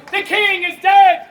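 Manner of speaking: slow, high, very loud proclamation; theatrical shout. Acted. Nobles of Scotland announce the king's death, shouted. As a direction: cry the news out to a crowd. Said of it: "the nobles of Scotland shout".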